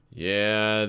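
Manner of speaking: ordinary talk, nothing marked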